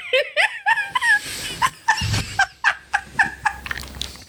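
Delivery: high wheezy laugh; helpless crying laugh; laughing fit. Real, not acted.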